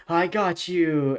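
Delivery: taunting jock. Acted. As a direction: call it out slowly like a menacing jock. Jock targets the shy girl.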